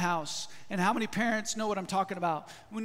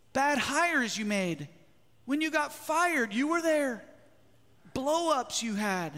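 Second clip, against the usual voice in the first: preaching emphasis; 5 semitones higher than usual. Real, not acted. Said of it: "preacher builds emphasis".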